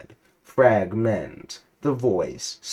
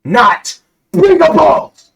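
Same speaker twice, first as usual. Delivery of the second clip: much higher, loud exasperated yell; frustrated shout; 15 dB louder, slower than usual. Acted. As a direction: snap at him, fed up. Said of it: "clearly frustrated".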